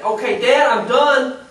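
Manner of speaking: loud, high mock announcement of giving up; imagined fed-up Jesus. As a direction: call it out to Dad, quitting. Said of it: "he could have just said".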